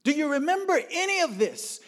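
high, shouted accusation; angry demand. Retold or imitated. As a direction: yell the question at your father. Rant at father resumes, louder.

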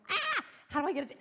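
high, loud, quick yelp of alarm; mock panic. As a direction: blurt it out in comic alarm.

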